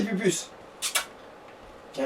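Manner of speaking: ordinary talk, nothing marked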